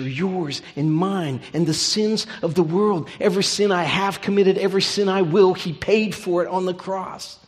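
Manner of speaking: loud, rising preacher's cry; fervent preaching. Acted. Pastor to congregation, proclaiming forgiveness, fervent loud preaching. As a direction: preach it out with full conviction.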